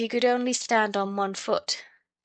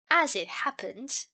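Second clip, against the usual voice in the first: exasperated triumph; 7 semitones higher than usual. A narrator's reading. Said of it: "in a tone of exasperated triumph".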